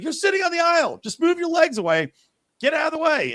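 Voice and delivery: high-pitched retold complaint; exasperated protest. Man arguing with woman blocking the aisle, exasperated.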